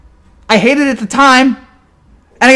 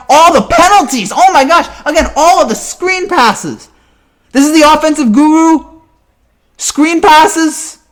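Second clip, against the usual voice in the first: angry fan yelling; 8 semitones higher, slower than usual. Real, not acted. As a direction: vent at the offense at full pitch. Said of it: "I had to scream and yell about that".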